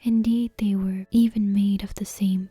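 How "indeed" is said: usual speaking voice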